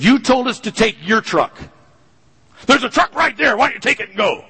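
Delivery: high, pained protest back at dad; hurt retort. Retold or imitated. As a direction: answer back, stung and indignant. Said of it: "I was instantly wounded".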